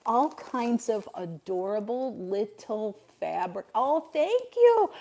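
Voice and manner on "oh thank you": high, slow gushing thank you; a swooning, delighted oh thank you. Real, not acted. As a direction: melt over the gift. Reacting to a gift.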